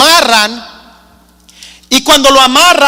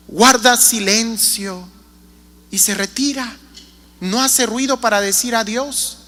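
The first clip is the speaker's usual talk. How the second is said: softening to quiet; 4 semitones lower, 9 dB quieter than usual. Real, not acted. Dios se retira en silencio.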